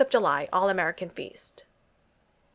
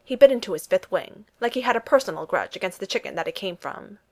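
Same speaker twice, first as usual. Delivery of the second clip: ordinary delivery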